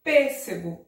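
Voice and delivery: Pêssego with loud first PÊ; stress on PÊ. Shown as a demonstration. Teacher reading the example, Pêssego with strong PÊ. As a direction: punch the closed PÊ of Pêssego. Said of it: "tem a antepenúltima forte".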